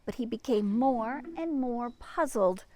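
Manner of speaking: lively storybook read-aloud; reading to children. A narrator's reading. Librarian reading to children, lively storybook voice. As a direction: read it like a picture book to kids.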